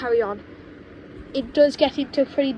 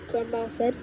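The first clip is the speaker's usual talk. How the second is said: usual speaking voice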